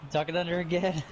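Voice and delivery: usual speaking voice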